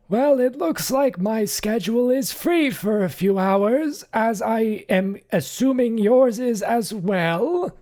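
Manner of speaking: high, loud, stiffly formal butler; a prim servant barely hiding annoyance; flabbergasted butler. Acted. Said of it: "He looks flabbergasted".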